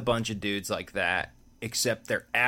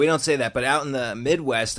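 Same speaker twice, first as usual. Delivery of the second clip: ordinary talk, nothing marked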